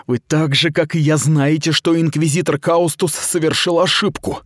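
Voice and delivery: a hint of pleading girl. Mita pleads with Aradai, urgent and earnest. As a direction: press your case, earnest.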